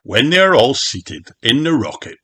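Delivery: ordinary delivery, nothing marked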